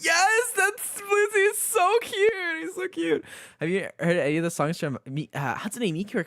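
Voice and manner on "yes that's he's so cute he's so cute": high, slow squealing gush; high slow swooning. Real, not acted. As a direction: melt over the cute scene. Gushing about a character.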